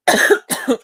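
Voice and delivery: sudden loud dry cough; real coughing fit. Real, not acted. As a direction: cough hard from a dry throat. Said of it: "Sorry if that's loud".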